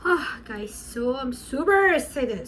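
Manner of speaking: ordinary talk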